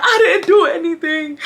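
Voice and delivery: high-pitched mock-tearful wail; crying denial. Teen outside pleading innocence to mom, mock crying.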